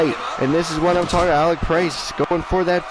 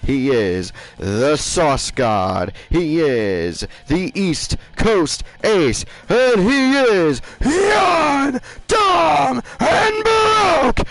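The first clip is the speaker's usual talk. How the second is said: ring announcer; 4 dB louder, much slower than usual. Acted. Announcer introducing the defending champion, soaring ring announcer voice. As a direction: belt out the champion's titles and name, very drawn out.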